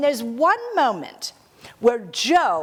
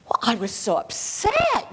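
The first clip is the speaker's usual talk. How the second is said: ordinary talk, nothing marked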